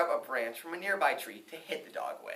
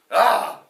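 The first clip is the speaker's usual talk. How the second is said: loud high yelp of fright; sudden shout of surprise; startled cry; 8 semitones higher, 13 dB louder than usual. Acted. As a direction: jump out of your skin with a yelp. Farmer startled by yam.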